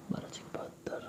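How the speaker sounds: barely audible whispered calculation; very soft, voiceless self-talk while calculating; whispered to oneself. Real, not acted. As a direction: whisper the sum under your breath. Working out sum to himself.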